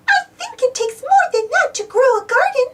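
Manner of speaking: very high, gentle Minnie. Acted. Minnie advising Donald about the garden, high and kind. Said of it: "said Minnie".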